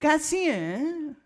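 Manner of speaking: "कैसी" drawn out slowly, pitched high and falling; loaded, sly greeting. Retold or imitated. Neighbour greets her with a pointed, mocking "कैसी हैं" drawn out slowly. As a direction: stretch "कैसी" slowly with a knowing fall. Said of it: "सीधी तो बात करनी नहीं ना कोई".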